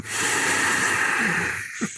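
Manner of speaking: long weary sigh; exasperated sigh. Retold or imitated. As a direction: let out a heavy frustrated sigh.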